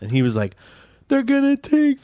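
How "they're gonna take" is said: mock bawling. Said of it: "starts fucking bawling".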